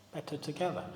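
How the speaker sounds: hushed, reflective Better together; soft echo. Shown as a demonstration. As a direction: let the phrase land quietly on its own.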